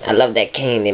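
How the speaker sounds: usual speaking voice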